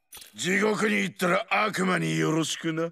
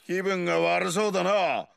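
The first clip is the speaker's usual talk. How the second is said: ordinary delivery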